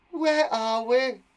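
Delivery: high, loud, drawn-out question; Jenny. Acted. Jenny asking where they are, high slow voice. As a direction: ask it slowly in a high character voice. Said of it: "asked Jenny".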